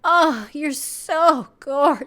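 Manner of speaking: slow, high, hammed-up swooning lover; cheesy romantic mock. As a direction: ham up a dramatic cheesy compliment. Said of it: "wasn't like".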